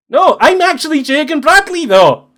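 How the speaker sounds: high, loud exclamation of protest; indignant protest. Acted. Game master as the man insisting who he is, indignant. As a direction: insist at the top of your voice.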